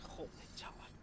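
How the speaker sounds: whispered curse under breath. Real, not acted. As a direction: breathe it out unvoiced. Muttered then shouted same words.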